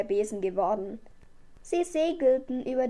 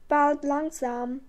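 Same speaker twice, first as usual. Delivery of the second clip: ordinary delivery, nothing marked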